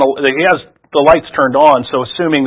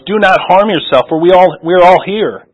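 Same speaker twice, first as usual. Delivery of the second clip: raised, higher urgent call; loud cry; 5 semitones higher, 5 dB louder than usual. A narrator's reading. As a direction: call out to stop him from harming himself. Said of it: "cried with a loud voice".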